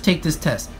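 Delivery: ordinary delivery, nothing marked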